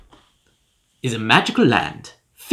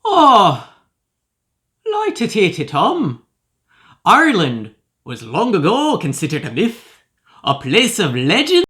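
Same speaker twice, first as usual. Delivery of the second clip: hammed-up, higher-pitched Irish brogue; 5 semitones higher than usual. Shown as a demonstration. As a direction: put on a lilting mock Irish accent. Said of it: "I'll be doing this examination in my famous Irish brogue".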